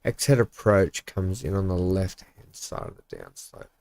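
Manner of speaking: low, soft read under the breath; reading to oneself. Real, not acted. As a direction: reread it quietly, half to yourself. Rereads a message to himself.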